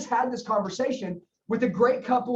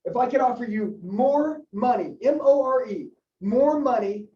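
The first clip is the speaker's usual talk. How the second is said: "more" set apart and hit hard; stress on MORE; 3 dB louder than usual. Shown as a demonstration. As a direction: punch MORE, then spell it out loudly. Trainer spells out the key word.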